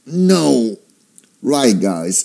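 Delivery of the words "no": high, emphatic falling "no"; shouted refusal. Acted. Patient facing a huge bill, shouted refusal. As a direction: protest with a mock shout. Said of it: "I'd scream bloody murder and shout".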